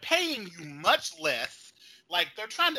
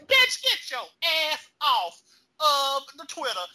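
mock scolding; 4 semitones higher, 5 dB louder than usual. Scolding the producer.

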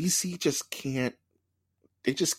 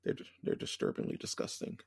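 disgusted whisper; 5 semitones lower, 8 dB quieter than usual. Real, not acted. Trash can phobia.

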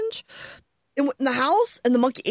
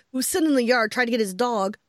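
usual speaking voice

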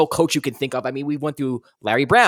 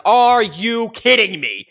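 slow, loud, disbelieving; fed-up fan can't believe it; incredulous; 6 semitones higher, 5 dB louder, slower than usual. Retold or imitated. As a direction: stretch out the disbelief. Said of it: "I'm like".

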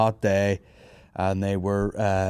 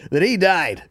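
a high mock news-anchor read; 11 semitones higher, 3 dB louder than usual. Comedian imagining the news reporting on him, high mock anchor voice. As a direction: play a TV newsreader announcing a scandal. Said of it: "Up next".

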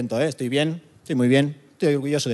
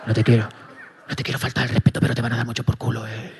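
lower, softer, half-whispered command; inner voice; 4 semitones lower, 4 dB quieter than usual. Pretend. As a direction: hush him like the voice in his head.

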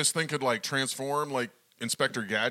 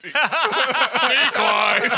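laughing; 10 semitones higher, 9 dB louder than usual. Pretend. Echoing the robot refusal.

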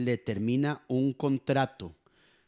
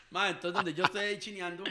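laughing through words; 4 semitones higher, 5 dB quieter than usual. Real, not acted. Laughs while telling baby story.

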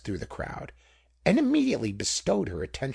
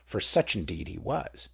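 ordinary delivery

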